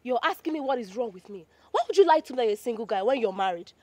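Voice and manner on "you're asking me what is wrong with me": ordinary delivery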